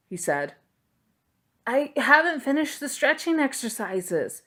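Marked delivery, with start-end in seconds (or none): 1.7-4.4 put-on higher, whiny young protest; a higher-voiced boy complaining. A narrator's reading. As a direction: plead it like a reluctant boy. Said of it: "Sophos protested".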